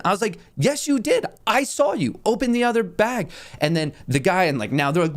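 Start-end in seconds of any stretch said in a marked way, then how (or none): 0.6-3.3 high-pitched, insistent accusing; retold accusation. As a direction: act out an indignant comeback, pitched up. Acted accusation in an argument.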